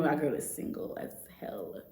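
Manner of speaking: whispered aside. Real, not acted. Confiding to viewers that she is single, whispered. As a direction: whisper it under your breath.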